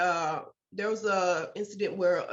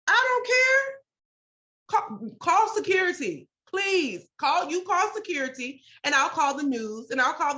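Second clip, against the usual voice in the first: high, loud furious outburst at the nurses; retold angry yell; 7 semitones higher, 4 dB louder than usual. Mom yelling at nurses to call security, furious. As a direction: hurl it back at them, daring them. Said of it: "I was pissed".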